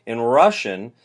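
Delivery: usual speaking voice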